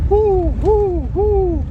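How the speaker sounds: high hooting owl call; eerie owl hoots. Acted. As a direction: hoo like an owl in the dark. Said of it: "I started hearing some weird sounds, like a".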